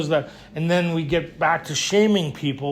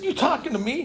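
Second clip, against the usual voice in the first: indignant challenge; 7 semitones higher than usual. Retold or imitated. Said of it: "I said".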